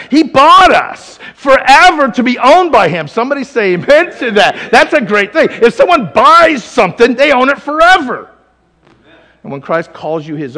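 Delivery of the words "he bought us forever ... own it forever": loud, high proclamation to a congregation; full-force preaching. Real, not acted. Preacher telling the congregation they were bought forever, full-force preaching.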